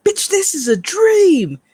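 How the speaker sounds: high, slow startled yell; dream realization. Retold or imitated. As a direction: shout it high as you catch yourself mid-dream.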